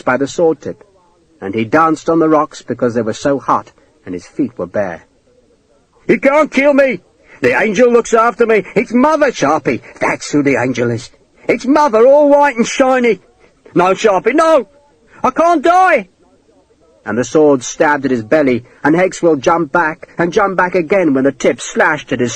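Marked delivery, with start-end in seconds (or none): 6.1-16.1 high, raving character voice; frantic shouting. A narrator's reading. Man driven back by a sword, frantic high cries. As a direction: rave at your attacker, pitch leaping up. Cornered villain keeps shouting at attacker.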